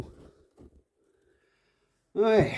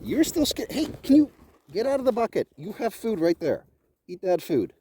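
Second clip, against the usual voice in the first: coaxing pet talk; 7 semitones higher than usual. Real, not acted. Coaxing a scared goat.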